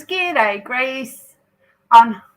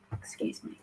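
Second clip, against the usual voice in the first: whisper; 21 dB quieter than usual. Real, not acted. Aside while live on stream.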